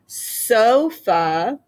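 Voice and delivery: sofa with a long, drawn-out SO; SO-fa, long open O stressed; stress on first syllable. Shown as a demonstration. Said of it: "first syllable is so".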